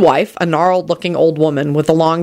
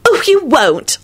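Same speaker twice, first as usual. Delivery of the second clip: high, fervent protest; passionate; 12 semitones higher, slower than usual. A narrator's reading. Elizabeth reassuring Lupin about exams, passionate high voice. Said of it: "Elizabeth said passionately".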